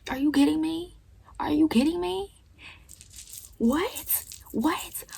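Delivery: high, incredulous retort; outraged disbelief. Retold or imitated. Teen snapping back at her mom, high and incredulous. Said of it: "I'm like".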